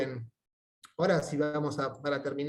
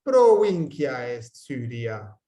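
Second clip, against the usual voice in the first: slow, loud, carefully spaced Latin; slow model sentence; 3 semitones lower, 5 dB louder, slower than usual. Shown as a demonstration. Teacher confirms a student's answer to the class, slow loud modelling. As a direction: give the answer slowly and firmly for repetition.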